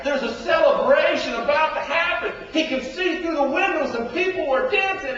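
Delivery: loud, high rising excitement; preacher painting the celebration; excited. Acted. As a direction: build the party scene with energy. Excited scene building.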